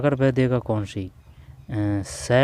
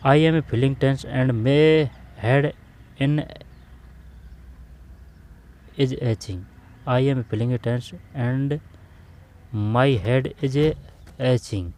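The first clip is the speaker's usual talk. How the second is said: slow dictation read; 2 semitones higher, much slower than usual. Shown as a demonstration. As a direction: spell the sentence out slowly for the class. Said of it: "teacher reads sentence aloud slowly". (धीमे-धीमे, ज़ोर देकर पढ़ना)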